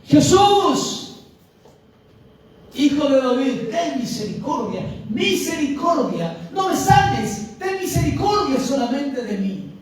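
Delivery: high, loud plea for mercy; clamor a gritos. Acted. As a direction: cry out the name, then plead in long, rising waves. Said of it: "el ciego gritaba más fuerte". (súplica clamada con fuerza)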